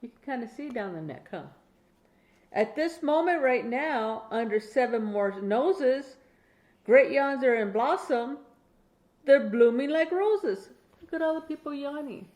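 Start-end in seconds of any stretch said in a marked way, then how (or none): 2.5-10.7 steady, clear bedtime story reading, a bit slower; reading to a child. Acted. Grandma reading a Dr Seuss bedtime book to her grandchild, clear storytelling. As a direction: read it out like a bedtime book for a little one.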